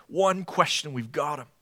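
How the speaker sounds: soft, gloating we-got-him; eager council whisper. Retold or imitated. Preacher voicing the council's eager glee. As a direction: hush it like they've finally caught him. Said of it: "you can see their anticipation".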